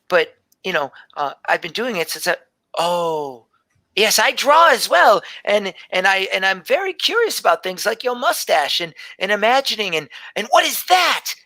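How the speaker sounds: a hint of a shy seven-year-old telling her dream; little girl Gelby. Gelby chatters to Edwin.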